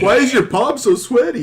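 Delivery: high, loud, laughing squeak; mock bewildered voice. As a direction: act baffled through laughter. Mock voice, hand mouth joke.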